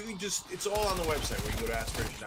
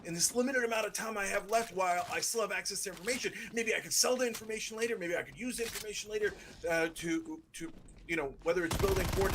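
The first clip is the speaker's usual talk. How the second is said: higher, quicker scheming voice; imitating Trump; 7 semitones higher than usual. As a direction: scheme it out fast. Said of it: "he's like".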